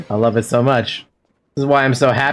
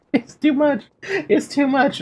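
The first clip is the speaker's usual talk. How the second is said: much higher, mock-sobbing whimper; mock crying; 14 semitones higher than usual. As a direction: fake a tearful wail. Mock crying over character.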